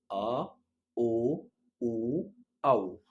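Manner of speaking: آ، أو، أو، أو called out loud and clear, one by one; teacher drilling آ، أو، أو، أو aloud; German vowels for repeating. Shown as a demonstration. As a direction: sound out آ، أو، أو، أو loudly for the class to echo. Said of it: "قولهم ورايا تاني".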